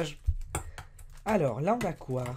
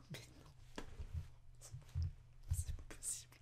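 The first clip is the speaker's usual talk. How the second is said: souffle à peine, sans voix; chuchoté incrédule; 20 dB quieter than usual. Real, not acted. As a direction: murmure n'en croyant pas ses yeux. Incrédule devant la culotte. (chuchotement sidéré, presque muet)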